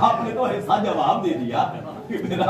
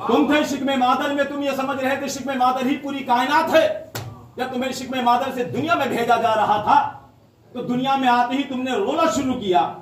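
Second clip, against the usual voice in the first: impassioned preaching; 9 semitones higher than usual. Acted. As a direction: lift it to the crowd, building fervour. Rising sermon delivery of Imam's answer. (امام کے جواب کو جوش سے سنانا)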